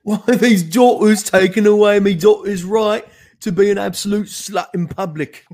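high, loud hammed-up British accent; mock British accent. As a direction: play the outraged British conservative to the hilt. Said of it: "I can't do the British accent".

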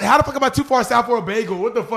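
loud, high, indignant retort; outraged retort. Retold or imitated. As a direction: fire back in disbelief at the insult. Retelling being mocked at gas station.

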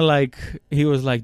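ordinary talk, nothing marked

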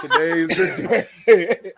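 laughing. Real, not acted. Echoing the joke, laughing slowly.

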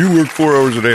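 loud, high-pitched imitated complaint; mock accusation. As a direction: throw it out like a heckler accusing. Voicing people's accusation about his hours.

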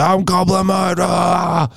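goblin mode growl. Retold or imitated. Husband announces his goblin mode, hammed-up goblin cry. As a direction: play the eager goblin. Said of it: "excited to go".

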